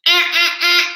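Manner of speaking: high playful uh-uh sounds; a goofy squeak to throw viewers off; silly high distracting noise. Real, not acted. Teasing live viewers with a goofy high squeak. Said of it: "Bet that'll distract you".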